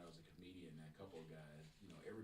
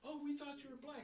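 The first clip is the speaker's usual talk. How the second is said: much higher, surprised quote; high surprised coworker voice; 5 dB louder than usual. Retold or imitated. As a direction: gasp it out in a high voice.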